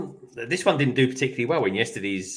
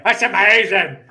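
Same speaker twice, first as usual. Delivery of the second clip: loud, high mock-Irish exclamation; teasing imitation of an Irish accent; 6 semitones higher, 8 dB louder than usual. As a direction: mimic an Irish accent, louder and higher. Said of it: "he's not Northern Irish".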